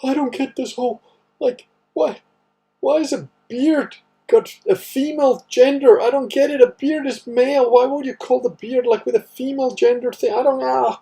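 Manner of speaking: high, loud, whiny confused foreigner; mock crusader. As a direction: squeak out a baffled learner's complaint. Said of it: "They're like".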